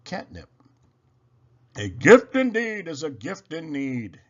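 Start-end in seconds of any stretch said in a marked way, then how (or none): 1.8-4.2 lightly voiced jolly Santa, a touch louder; hint of a warm old Santa; Santa's voice. A narrator's reading. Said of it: "he says".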